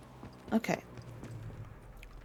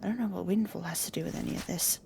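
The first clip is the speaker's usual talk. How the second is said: normal talking voice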